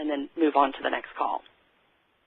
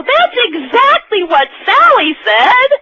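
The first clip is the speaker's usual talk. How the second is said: hammed-up suitor; 4 dB louder than usual. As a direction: ham up a hopeful pickup line. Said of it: "And you go".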